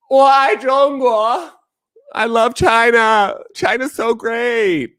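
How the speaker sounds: high, drawn-out mock adoration; gushing China fan. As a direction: parody a fawning fan, slow and high. Mimicking gushing China praise.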